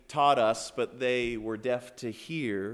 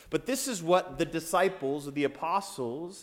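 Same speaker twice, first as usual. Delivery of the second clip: normal talking voice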